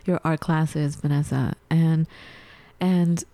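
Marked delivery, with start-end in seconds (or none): none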